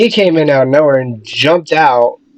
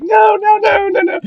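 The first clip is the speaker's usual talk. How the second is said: much higher, crying shout of no; panicked crying no. Retold or imitated. Scared judge pushing away the costumed guy, crying shout. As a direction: shriek it like you're terrified and sobbing. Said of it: "She's like".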